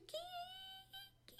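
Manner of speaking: high puppy squeals fading away; long, high, dwindling kee; fainter yelps. A narrator's reading. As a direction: yelp as if receding across a meadow. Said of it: "fainter and fainter".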